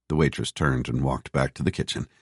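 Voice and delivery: ordinary delivery, nothing marked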